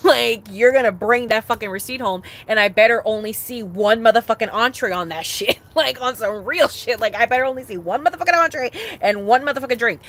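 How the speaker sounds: half-joking fired-up rant; loud rant. Real, not acted. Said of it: "ranting about receipt".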